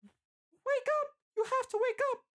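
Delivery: very high falsetto cry. A narrator's reading. A distant voice begs dying Bill to wake, crying out. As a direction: cry out desperately in a woman's falsetto. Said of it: "You hear a voice cry out".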